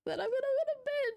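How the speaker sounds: very high, fast squeak; squeaky high voice. Pretend. Squeaky sudden line.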